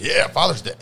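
high, loud happy cheer; excited. Retold or imitated. Excited quote of himself.

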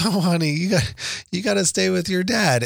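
lightly voiced lady soothing a kid; imitated kindly lady. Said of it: "And she laughs goes".

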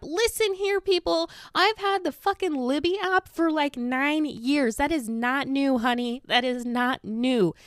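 high, emphatic scolding, words stretched out; indignant rant. Real, not acted. Setting people straight about the Libby app, indignant. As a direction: lay down the law, exasperated.

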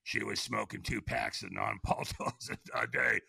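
slow, raspy chain-smoker voice; smoker's voice. Shown as a demonstration. He imitates the AA phone woman, slow and raspy. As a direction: talk like a two-pack-a-day smoker. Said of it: "she talked like this".

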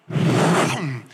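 whooshing boom noise; mouth-made thud, low and sudden; imitated impact. Preacher acts out the moment he died, imitated boom.

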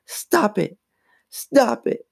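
high-pitched scream held back in volume; mild mock scream. A narrator's reading. Mom cuts off the girls, restrained scream. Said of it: "Missus Powell screamed".

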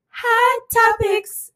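high, drawn-out title call; segment announcement. Pretend. Co-hosts redo the intro together, high drawn-out call. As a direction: stretch out the segment name.

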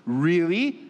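drawn-out rising disbelief; incredulous. Real, not acted. Preacher reacting to Israelites' complaint, incredulous. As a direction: swoop up on the word as if astonished.

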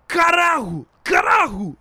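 loud, high Carajo hurled out; shouted Spanish curse. Shown as a demonstration. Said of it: "rattle it off".

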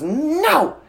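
high-pitched mock tantrum shout; imitated toddler yell. Toddler refusing her dad, imitated high yell. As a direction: shriek it like a defiant toddler. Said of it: "when she yells".

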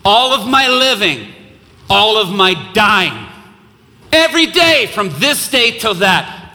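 new believers shouting their vow at baptism; shouted pledge. Retold or imitated. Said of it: "they shout out".

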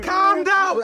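high, loud mock Scouse accent. Teasing a friend's failed [name], in mock Scouse accent. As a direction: ham up a high-pitched comedy Scouse. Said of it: "[name] from the Scousers".

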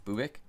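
Bubic as "BOO-bik", questioning; trying a pronunciation. Real, not acted.

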